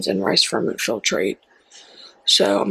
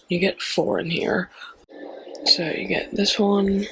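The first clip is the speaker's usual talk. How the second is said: whisper; 4 dB quieter than usual. Acted. Whispering while showing blenders.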